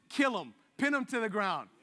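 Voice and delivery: a hint of a fierce zealot; zealous Phineas. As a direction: bark it like a mock battle order. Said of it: "his response is".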